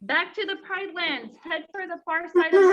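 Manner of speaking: high squawking bird call. A narrator's reading. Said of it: "squawked Zazu".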